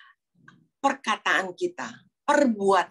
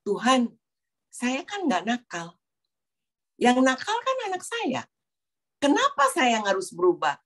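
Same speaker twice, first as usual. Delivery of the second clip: higher-pitched, indignant complaining; retold protest; 7 semitones higher than usual. Mother retelling her prayer to God, protesting in a higher voice. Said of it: "Protes ya sama Tuhan".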